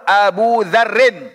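"Abu Dzarrin" with long u ending Abu; waw reading. Shown as a demonstration. Teacher giving an example with waw, "Abu" with long u. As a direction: slowly model "Abu Dzarrin", drawing out the u. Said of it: "Pakai waw".

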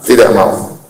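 ordinary talk

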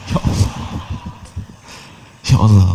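ordinary talk, nothing marked

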